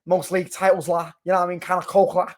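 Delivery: hammed-up Scouse lad; bad Scouse accent. Liverpool fan hyping up the lads, in a bad mock Scouse accent. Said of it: "with that fucking shit accent".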